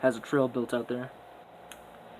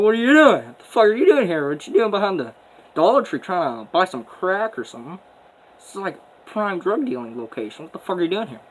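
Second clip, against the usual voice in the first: loud, high-pitched imitation of an angry woman; hammed-up indignant tirade, starting at a near-shriek and easing down; mock snapping off; 6 semitones higher, 8 dB louder than usual. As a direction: mimic her going off on the boys. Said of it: "Land Whale starts snapping off".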